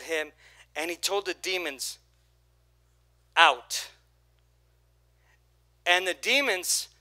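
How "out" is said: a low, firm command to cast out; commanding Jesus. Retold or imitated. Jesus ordering the demons out, firm command. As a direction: cast them out with one word.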